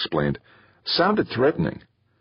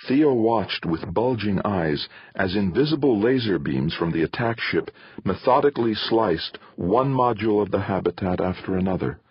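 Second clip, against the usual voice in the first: plain reading voice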